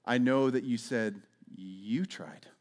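gentle Jesus. Retold or imitated. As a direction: kindly ask them what they think. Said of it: "Jesus looking at them going".

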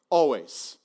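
ordinary talk

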